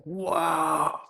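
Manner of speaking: high, slow, hushed amazement; drawn-out awed wow; awestruck wonder. Retold or imitated. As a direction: gasp it out like seeing magic for the first time. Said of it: "And I'm just like".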